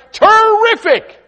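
high, loud, slow stretched "Terrific!"; excited answer. Retold or imitated. Church member answering the pastor with great excitement. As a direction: belt it out with joy. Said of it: "How you doing, Larry?".

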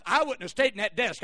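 ordinary delivery

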